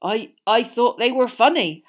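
hesitant, stammering giant; unsure of himself; uncertain Hagrid. A narrator's reading.

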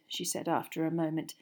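plain reading voice

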